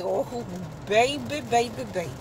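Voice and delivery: put-on soft, hushed baby, baby, baby; mock consoling. Comforting the cheated-on wife, soft and tender. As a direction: coo it soothingly.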